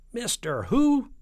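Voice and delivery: drawn-out, high incredulous scream. Acted. President disbelieving the voice on the phone, screaming. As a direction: scream it slowly, shooting up high. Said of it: "screamed the president".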